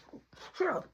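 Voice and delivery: soft urgent hush. Retold or imitated. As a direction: hush him quickly. Hushing the kid.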